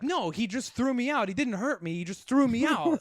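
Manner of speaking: high-pitched imitation of an insistent ex. Ex answering whether she was hurt, mock-insistent. Ex correcting the cops, acted.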